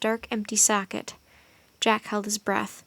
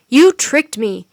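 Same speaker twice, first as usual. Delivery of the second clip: much louder angry accusation; furious cry; 9 dB louder, slower than usual. A narrator's reading. Wizard cries at the children who tricked him. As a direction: throw the blame at them. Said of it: "cried the wizard".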